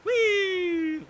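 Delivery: high, joyful whee down the slide; excited squeal. Real, not acted. Player riding his water slide, squealing with glee. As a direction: let out a gleeful squeal.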